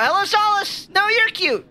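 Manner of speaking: very high, squeaky gushing; gushing greeting. Real, not acted. Streamer greets a viewer sweetly.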